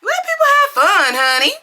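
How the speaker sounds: loud fed-up plea with a laugh; exasperated outburst. Real, not acted. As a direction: throw your hands up and call it out loud. Exasperated at party poopers.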